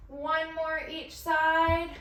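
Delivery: slow, high and loud, every word stretched; urgent push. Real, not acted. Coach pushing the class through the last reps, slow and loud. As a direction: drag out the count to push them through.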